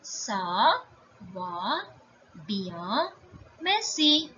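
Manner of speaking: "Ça ... va ... bien ... merci", each apart; word by word. Shown as a demonstration. Teacher giving the French answer, word by word. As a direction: pause after every word, model it clearly. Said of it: "how will you say".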